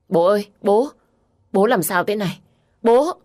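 high, loud calls to dad; desperate son, peaking on the last call; panicked cry. A narrator's reading. Son finds his father dying. (con trai hốt hoảng kêu bố)

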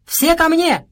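high, loud call through cupped hands; shout. Acted. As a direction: call everyone over at full voice. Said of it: "сложил руки рупором и крикнул".

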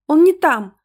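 put-on high, loud little-girl voice; child's insistent interjection; urgent child. A narrator's reading. Child urgently stops her mother.

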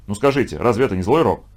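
ordinary delivery, nothing marked

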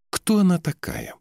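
high-pitched, challenging question; jealous demand. A narrator's reading. Jealous Ulla demands who woman is. (ревнивый требовательный вопрос, высоким голосом)

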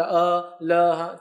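الحمدللہ drawn out slowly, then ا، ل، ہ spoken letter by letter; slow letter-by-letter spelling; spelled out slowly. Shown as a demonstration. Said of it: "Roman میں عربی لکھا ہوا".

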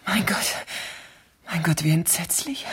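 shocked, breathless whisper; horrified whisper. Acted. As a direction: whisper in horror to herself. Horrified after overheard murder plot.

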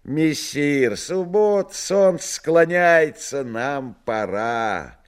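high, slow, quavering rattle; a trembling creaky servant voice; rattling voice. Acted. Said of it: "Третий дребезжащий голос сказал".

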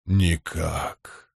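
low, soft, final godlike voice; rumbling Stormfather. A narrator's reading. Storm god refuses hope, low soft rumble. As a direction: drop it low like far thunder. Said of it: "Голос загрохотал точно далёкий гром".

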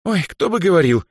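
high, breezy brush-off; careless, high-pitched retort; carefree dismissal. A narrator's reading. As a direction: wave the criticism away lightly. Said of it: "беспечно отмахнулась от неё Даяна".

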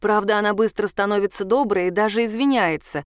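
ordinary delivery

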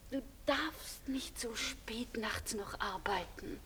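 slow, breathy whisper into the receiver; tender whisper. Acted. Woman on the phone gently scolding her lover, whispered. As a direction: hush it like a lover's caution.

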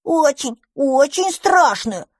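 demanding little dragon. Acted. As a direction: beg hard like a small kid. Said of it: "потребовал дракончик".

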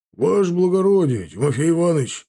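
high, loud call to an officer; calling from afar. A narrator's reading. Lookout hailing his officer from afar, calling out. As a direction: call out as if across a distance. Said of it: "донёсся голос Кошелева".

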